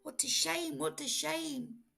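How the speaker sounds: high singsong dismay; mock disappointed. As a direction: lament it theatrically. Cat disappointed.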